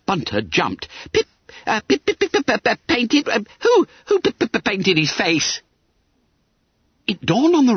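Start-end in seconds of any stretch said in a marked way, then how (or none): 1.1-5.6 high squeaky stammer on P; startled spluttering. A narrator's reading. Bunter learns his face is painted, spluttering. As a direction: pop out repeated syllables in shock.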